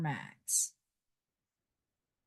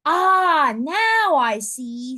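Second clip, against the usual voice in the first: higher, louder slow dawning ah; sudden realization; 6 semitones higher, 7 dB louder, much slower than usual. A narrator's reading. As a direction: let it dawn on you slowly. Max suddenly understands.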